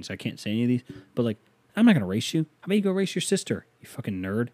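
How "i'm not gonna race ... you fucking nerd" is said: higher, sneering insults; mocking comeback. Man imagines insulting the thirteen-year-old, high mocking jeer. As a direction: taunt the kid with a pitched-up jeer. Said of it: "I just thought of all these comebacks".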